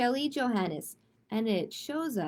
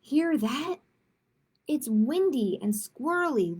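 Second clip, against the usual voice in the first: bouncy, louder picture-book reading; lively rhyming read-aloud; 3 dB louder, slower than usual. A narrator's reading. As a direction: read each rhyme like a game for kids.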